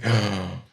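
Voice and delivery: acted gasp of realisation; relieved gasp. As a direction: gasp as the overwhelmed king. King's reaction, acted.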